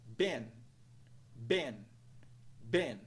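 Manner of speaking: "been" as one syllable, like "Ben"; isolated model word "been", rhyming with "ten"; E vowel. Shown as a demonstration. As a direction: say "been" whole, with short E. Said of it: "que soa como E".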